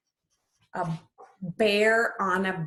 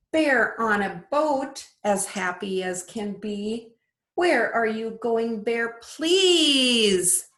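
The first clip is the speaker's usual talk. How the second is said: lilting storybook read-aloud; sing-song picture book voice; reading to children; 3 semitones higher, 5 dB louder, slower than usual. Acted. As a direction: read it like bedtime story time for little ones. Teacher reads picture book aloud.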